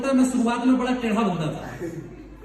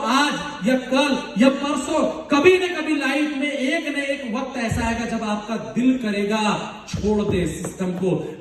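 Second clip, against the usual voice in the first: slow dramatic; slower than usual. Acted. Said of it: "motivational slow delivery".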